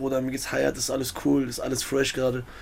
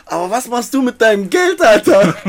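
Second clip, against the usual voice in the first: higher, louder voicing of a seasoned rapper; 5 semitones higher, 8 dB louder than usual. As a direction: mimic a friend lecturing you about money, half laughing. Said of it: "er sagt so".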